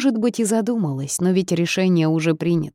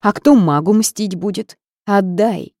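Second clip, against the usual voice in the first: angry; 4 semitones higher, 6 dB louder than usual. A narrator's reading. Witch fights over the book.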